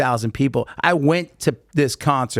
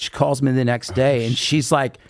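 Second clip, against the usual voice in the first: ordinary delivery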